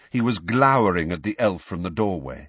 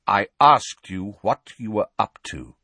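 firm, louder demand; icy authority; cold; 3 semitones higher, 6 dB louder than usual. A narrator's reading. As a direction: repeat the question coldly. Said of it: "said Sirius coldly".

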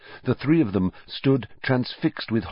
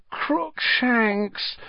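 long moaning call, much higher; 13 semitones higher than usual. A narrator's reading. Hermione dismayed at her cat, moaning. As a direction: moan the cat's name in dismay. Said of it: "Hermione moaned".

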